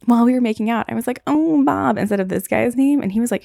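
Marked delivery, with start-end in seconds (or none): 1.3-2.0 1 mock breathy moan. Moaning the wrong name.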